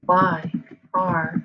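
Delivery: word by word, long pauses between; halting, piecemeal delivery while typing; typing aloud. Real, not acted. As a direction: dictate slowly to yourself as you type. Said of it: "I'm typing like".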